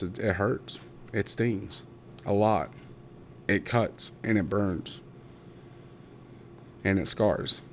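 softly hurt, with long pauses; a little emotional. Real, not acted. Speaker telling how little support they got, quietly emotional. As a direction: let each hurt land quietly, holding back. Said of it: "a little emotional".

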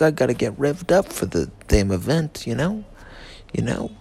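ordinary talk, nothing marked

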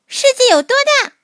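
put-on high, eager child voice; excited small creature asking; little mole voice. A narrator's reading. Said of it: "小鼹鼠问鲸鱼".

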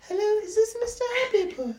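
put-on high polite girl's phone voice; fake girl's voice. Calling dad back, high fake girl voice. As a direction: pitch way up, sweet and formal. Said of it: "girl's voice".

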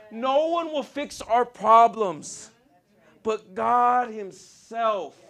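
slow, high-pitched, insistent declarations; fervent preaching. Real, not acted. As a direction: preach it with conviction, stretching the key words. Preaches at full force.

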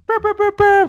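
high, loud rolled "brr" drumroll; playful vocal drumroll; mock drumroll. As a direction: hype up the outro with a drumroll. Drumroll before JB's outro.

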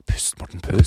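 hushed pep talk to oneself; whispered self-calming. Retold or imitated. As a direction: whisper to yourself to breathe. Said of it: "var litt sånn".

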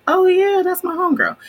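high, loud happy dog voice. Retold or imitated. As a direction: voice the dog greeting a friend. Voicing the dog Dempsey.

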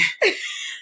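very high squealing laugh; shrieking laughter. Real, not acted. Friend joking about crying on cue, shrieking laughter.

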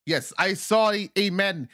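put-on African comedian accent. African comic joking to an American crowd, African accent. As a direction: play an African comic telling a joke, laughing at it.

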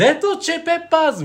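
high, hammed-up foreign-accent band name; mock Hungarian accent shouting the band name. Imitating the foreigner saying band name.

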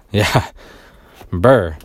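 sighing, shivering in the cold; cold shiver sound brr. Real, not acted. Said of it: "I'm freezing right now".

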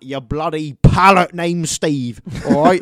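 shouted, slowed, exasperated; loud exasperated outburst. Real, not acted. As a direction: blow up at the last item, slow and loud. Exasperated rant about who to tell.

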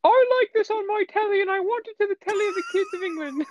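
high posh mock British accent; Queen impression. Imitating the Queen of England in a high British accent. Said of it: "continuing the Queen bit".

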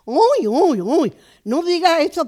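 retold gasp of shock at her sleeping under the cart; shocked exclamation. Shocked man reacts in retold story.

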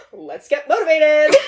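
high, loud chirpy cheer; a hint of a peppy mom. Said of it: "we'll just be like".

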